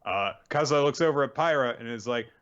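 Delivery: usual speaking voice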